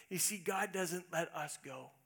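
ordinary talk

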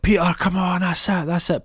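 high, loud cheer for Peter; excited cheering. Retold or imitated. Team cheering Peter after the assist, excited shout. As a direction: cheer him on like the whole team. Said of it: "And then everyone's like".

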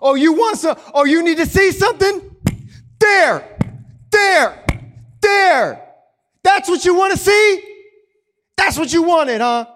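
furious shouting taunt; bludgeoning anger. Acted. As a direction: beat the rock with your voice. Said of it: "we bludgeon the rock".

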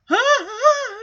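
high, mock-panicked gasps. Henry turns from the curtain, panicked gasping. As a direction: gasp in exaggerated alarm. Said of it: "He's got this panicked look".

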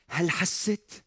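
ordinary talk

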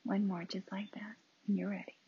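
calm breathing cues with long silences; unhurried meditation leader; guided meditation. Real, not acted. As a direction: guide each breath, leaving room after it.

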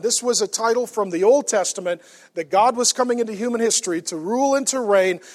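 ordinary talk, nothing marked

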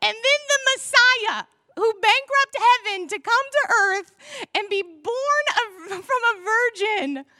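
high, loud, laughing disbelief; laughing as if it were ridiculous; incredulous mock retelling. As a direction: ham up the absurd ending, voice soaring.

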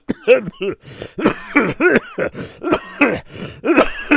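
choking cough in the wind; hacking coughs from the dust; loud cough. Acted. Wind blows dirt.

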